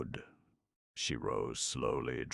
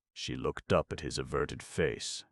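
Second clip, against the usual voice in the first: ordinary delivery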